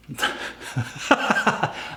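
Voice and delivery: breathy laugh. Real, not acted. Laugh after joke comparison.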